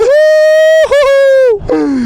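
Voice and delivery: high shrieking laugh; shrieking jackpot winner. Real, not acted. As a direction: shriek with delight. Ecstatic riding.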